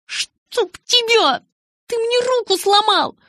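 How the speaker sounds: very high, loud, furious wail; pained outrage. Acted. Bella blaming Jacob for her broken hand, outraged wail. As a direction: scream blame through the pain.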